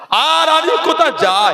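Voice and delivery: high, slow, mournful wail; anguished cry. Acted. Husain weeping to Allah with nowhere to go, anguished cry. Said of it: "আড়ালে কাঁদতেন আর বলছেন".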